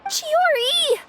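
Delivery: high urgent call after her; desperate call as she leaves. Acted. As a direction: shout for her to wait. Calling after departing friend.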